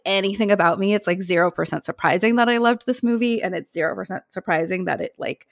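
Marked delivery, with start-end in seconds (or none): none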